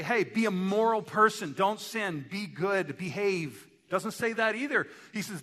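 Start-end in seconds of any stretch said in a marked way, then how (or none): 0.0-3.7 raised, louder list of orders; mock moralizing commands. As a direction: preach rules like a scolding moralist. Voices moral commands Jesus doesn't give.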